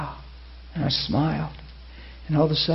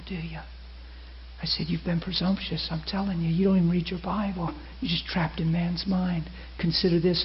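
softly spoken, gentle confrontation; quiet, measured pastoral appeal; real soft; 9 dB quieter than usual. Retold or imitated. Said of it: "And I stayed real soft".

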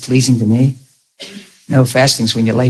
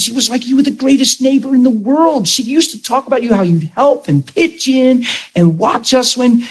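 high excited voice, rising with recognition; 10 semitones higher than usual. Acted. Man greeting his childhood neighbor, high and excited. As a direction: sound thrilled to remember him.

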